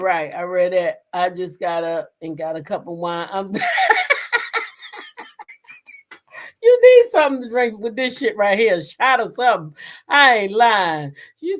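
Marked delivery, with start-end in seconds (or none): 3.6-5.7 long high wheezing laugh. Real, not acted. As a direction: dissolve into laughter. Laughing over a cup of wine.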